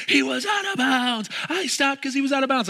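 high, loud, frantic yelling; screaming defender. Retold or imitated. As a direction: yell it like a player losing his mind. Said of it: "he was screaming and yelling".

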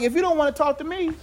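high, soft voice of God shrugging it off; God's voice, unbothered. Retold or imitated. God to someone who won't talk, high and unbothered. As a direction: pitch it up and lightly, unconcerned. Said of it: "So God's like".

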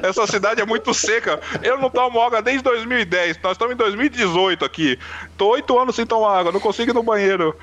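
high-pitched exasperated outburst; acted-out frantic complaint, pitched well up; retold panic. Man stuck in dry Brasília to worried Luana, mock panic.

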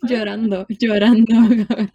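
real laughter breaking through; laughing loudly. Real, not acted. Laughing retelling her crying.

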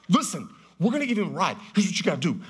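fast, hurried orders; rushed instructions. Retold or imitated. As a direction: spit it out with no time left. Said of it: "I had no more time to explain".

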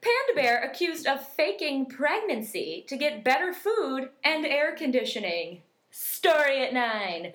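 very slow, measured headline read; drawn-out anchor delivery; news voice. Acted. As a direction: announce it like a TV news teaser. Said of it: "weird news".

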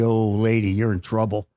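low, quiet mock threat; mock menacing threat. Preacher imitating his own urge to snap at a rude woman, low and quiet mock menace. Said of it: "my initial reaction would have been really".

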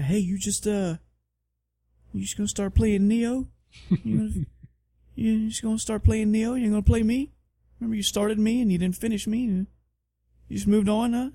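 Dark Souls three guilting the player who abandoned it; jilted game voice. Retold or imitated. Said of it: "there's Dark Souls three".

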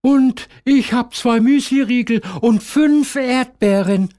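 put-on high, bright, pleased child; happy. A narrator's reading. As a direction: show off your snack happily. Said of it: "freut sich Diana".

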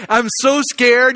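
ordinary delivery

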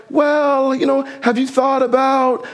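high, drawn-out "well", easing into it; tactful hedging. Acted. Letting someone down easy, tactful hedging. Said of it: "sort of massage it".

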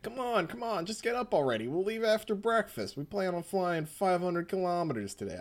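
put-on a pushy New York accent, nagging them to get up; New Yorker accent Polnareff. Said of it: "I did a New Yorker accent for Polnareff".

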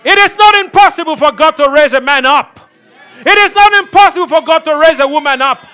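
rising, shouting preacher cadence; preaching at full force. Acted. Preacher stirring the church, full shouting force. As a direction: preach it out to the congregation.

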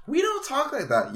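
high prissy mock voice. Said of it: "It's like".

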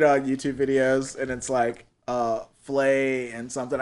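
normal talking voice

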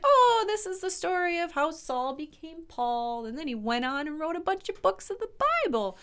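mock-naive, high and airy retelling; glossing over. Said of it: "I think I gloss over this story".

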